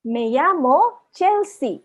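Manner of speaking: very slow "Me llamo Chelsea", word by word; measured teacher's model with drawn-out vowels; slow pronunciation demo. Shown as a demonstration. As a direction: model "Me llamo Chelsea" for learners, pausing between words. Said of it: "vowels को कैसे pronounce करते हैं".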